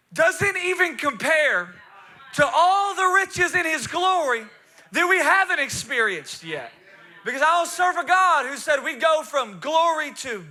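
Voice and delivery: loud, high, emphatic proclamation; full-force preaching. Real, not acted. Preacher proclaiming God's glory to the congregation, forceful. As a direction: build it like a Pentecostal preacher.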